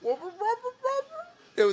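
put-on high wailing child voice; little kid voice. Shown as a demonstration. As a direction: cry out like a small child. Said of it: "in a little kid voice".

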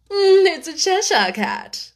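haughty duchess voice; lofty noblewoman, condescending; imperious plummy grande dame. A narrator's reading. Duchess voice in story.

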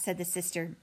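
usual speaking voice

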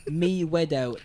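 put-on slow letter-by-letter spelling in a British accent. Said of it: "British accent".

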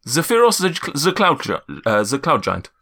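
halting announcement in a put-on accent; Zephyros. Giant giving his title, halting accented voice. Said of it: "my accent's just all over the place".

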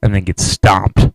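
ordinary talk, nothing marked

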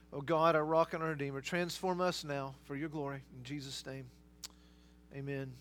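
hushed pastoral prayer. Real, not acted. Leads the congregation in prayer.

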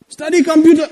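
high-pitched scolding relative; lecturing relative. Retold or imitated. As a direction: raise the pitch, nag like an elder. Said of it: "They gave me a lecture".

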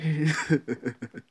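high hearty laugh; loud laugh. Real, not acted. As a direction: burst out laughing. Laughs at own joke.

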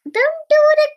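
very high, loud telling-off; acted cross warning. Giant warns his brother before sleeping, cross. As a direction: snap it high at a pesky brother.

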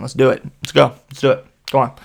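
loud, punchy bursts; rally the group in short loud pushes; eager urging. Retold or imitated. Always that person pushing friends on, eager.

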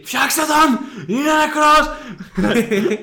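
hammed-up high, loud emergency cry; comic panic at full volume; mock panicked shout. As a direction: yell like a frantic medic, drawing out the end. Voices panicking hospital staff in joke.

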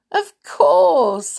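high, loud, jolly dwarf reply; chuckling. A narrator's reading. Happy answers Bashful at the castle, lightly chuckling. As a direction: voice a merry dwarf, mock chuckle in it. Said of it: "Happy chuckled".

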